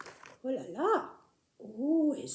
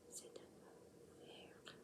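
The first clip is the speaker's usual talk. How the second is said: whispering; 30 dB quieter than usual. Acted. Whispered answer.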